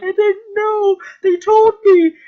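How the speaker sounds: high, loud, hammed-up wail of denial; mock crying plea. Betrayer pleads with the aliens, in a hammed-up high wail. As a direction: cry it out as a caught traitor, mockingly. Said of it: "starts crying".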